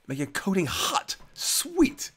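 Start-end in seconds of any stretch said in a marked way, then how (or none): none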